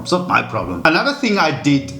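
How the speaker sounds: ordinary talk, nothing marked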